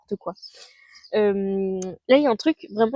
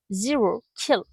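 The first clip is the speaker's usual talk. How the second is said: English accent; slower than usual. Shown as a demonstration. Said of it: "mon accent anglais". (avec l'accent anglais)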